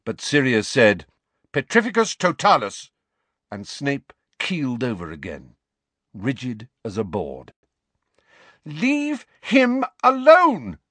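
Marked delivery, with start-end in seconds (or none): 1.5-2.9 high, forceful incantation; spell casting. A narrator's reading. Sirius casts a curse at Snape, forceful. 8.6-10.8 high, slow, furious shout. A narrator's reading. Lily defends Snape from his bullies, shouting. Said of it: "Lily shouted".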